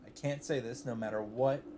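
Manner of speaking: ordinary talk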